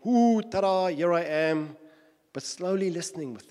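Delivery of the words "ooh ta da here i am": much higher and louder, sudden showy reveal; hammed-up loud surprise appearance; mock ta-da entrance. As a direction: burst in like a stage magician. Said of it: "abruptly appearing and saying".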